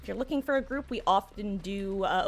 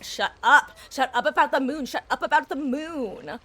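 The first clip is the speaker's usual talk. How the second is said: exasperated imitation of a screaming character, raised pitch; mock screaming; 5 semitones higher, 5 dB louder than usual. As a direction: mimic his annoying yelling, louder and higher. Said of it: "He just screams, like".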